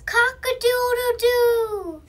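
high, loud cock-a-doodle-doo; rooster crow. A narrator's reading.

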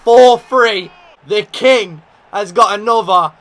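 celebrating the captain's goal; ecstatic goal shout. Real, not acted. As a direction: roar the goal.

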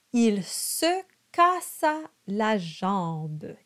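slow, careful French: monta with a nasal O; slow French model. Shown as a demonstration. Coach reads the verse slowly for students. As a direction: sound out each French syllable. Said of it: "those bright ah sounds".

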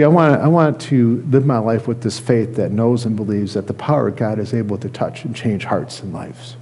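ordinary talk, nothing marked

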